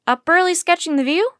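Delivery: loud, high question rising at the end; hearty hailing. A narrator's reading. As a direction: hail them heartily with a question. Teddy hails the arriving children.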